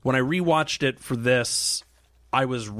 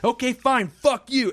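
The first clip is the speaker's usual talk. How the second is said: retold shouting match, heated at the end; escalating quarrel; 6 semitones higher than usual. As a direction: act out a row boiling over. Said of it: "it just escalated that badly".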